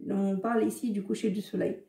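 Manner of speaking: ordinary delivery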